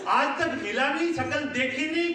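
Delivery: normal talking voice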